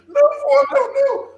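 put-on very high, loud child's wail; a locked-in little boy wailing at his grandmother to stop; crying child pleading. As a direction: beg grandma to let you out, in tears.

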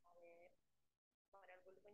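very faint voice over a bad line; barely audible, far-off mic; faint audio. Real, not acted. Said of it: "Ahora te escucho bajito".

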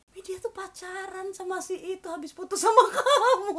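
gossipy quote dissolving into laughs; gossip breaking into laughter. Retold or imitated. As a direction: start hushed and high, burst out laughing.

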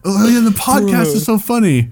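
high, gushing fan voice; excited fan. Retold or imitated. Fursuiter praising the podcast, imitated excitement. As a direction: imitate a fan's eager praise. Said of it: "And I".

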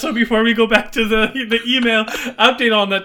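high, loud hyped-up announcing; loud announcement. Real, not acted. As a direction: announce it like big news. Playful announcement of update.

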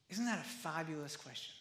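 ordinary talk, nothing marked

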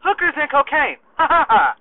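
high, jokey retort with a mock laugh; joking. Soldier kidding his sergeant about his spending, jokingly. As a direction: toss it off as a gag, then ha-ha. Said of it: "looks at me jokingly and says".

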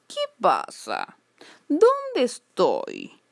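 slow, stretched-out sleepy cat voice; waking cat. A narrator's reading. Fat cat woken by a girl, slow stretching drawl. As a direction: drag it out as if stretching awake. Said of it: "preguntó el gato desperezándose".

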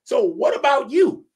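mock him through the laughter; laughing shout of disbelief; laughing challenge. Real, not acted. Mocking a rival, laughing.

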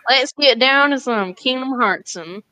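playful cowboy-style Southern twang; hammed-up Southern accent. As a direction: ham it up like a Southern cartoon pony. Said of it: "in My Little Pony Southern accents".

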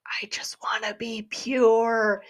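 slow, hushed whisper, imitating the character; slow mock-pleading whisper; imitated breathy plea. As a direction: whisper it slowly, drawing out each word. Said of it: "he just goes".

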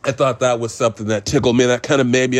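ordinary talk

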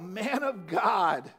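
slow drawn-out cry to God. Acted. Poisoned men crying to the prophet, slow cry. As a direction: stretch it out like a desperate plea. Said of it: "cry out".

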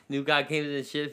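ordinary talk

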